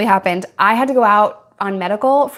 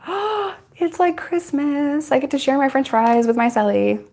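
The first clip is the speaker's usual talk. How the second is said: mock delight; 5 semitones higher than usual. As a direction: squeal it like a kid at Christmas. Retold glee at getting through.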